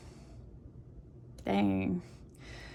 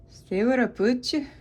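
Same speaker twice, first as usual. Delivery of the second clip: put-on Gaelic accent, higher pitch; 5 semitones higher than usual. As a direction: lilt it up like a playful Gaelic speaker. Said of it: "Yes, that was my Gaelic accent".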